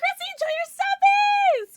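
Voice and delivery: high, loud squealed shout-out; bright excited greeting. Real, not acted. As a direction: squeal it out to the fan. Cheery shout-out to a viewer.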